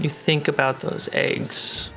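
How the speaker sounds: usual speaking voice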